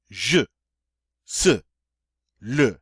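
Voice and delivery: je, se, le with a forceful uh vowel; forceful uh sound. Shown as a demonstration. Teacher repeating je, se, le for students. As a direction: punch the uh in Je, se, le. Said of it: "forceful sound".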